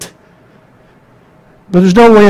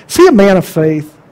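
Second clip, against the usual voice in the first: ordinary talk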